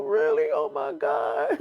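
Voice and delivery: squeaky, very high mock crying; hammed-up emotional. Grateful woman about to burst into tears, hammed-up emotional. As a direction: imitate her overcome with joy, drawn out and sky-high. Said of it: "gets all emotional".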